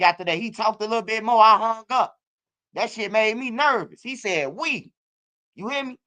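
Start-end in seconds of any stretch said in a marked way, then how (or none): 4.5-4.9 "We." set apart and pitched high; pointed emphasis on "We." as the giveaway word; stress on We. Retold or imitated. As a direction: land hard on the single word "We.". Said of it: "when you say I, we, or they, it's a difference".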